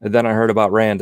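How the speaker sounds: ordinary talk, nothing marked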